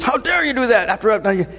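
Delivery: high-pitched indignant scolding; mock angry outburst. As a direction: act out the anger he doesn't have. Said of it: "he's not angry".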